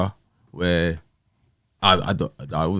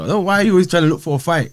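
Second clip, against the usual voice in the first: higher, louder, rushed complaint; raised, quick frustrated retort; exasperated, fast; 10 semitones higher, 6 dB louder, faster than usual. Retold or imitated. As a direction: fire the question back at her. Retold argument with ex.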